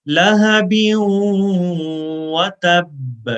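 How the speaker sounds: slow, drawn-out recitation, a held pause on و. Shown as a demonstration. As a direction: stretch the words and hold the nasal و a second. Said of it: "one second کے برابر رکیں گے".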